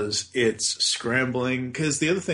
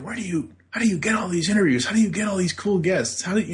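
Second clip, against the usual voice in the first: curious fans asking; 7 semitones higher, faster than usual. Retold or imitated. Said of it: "everybody's like".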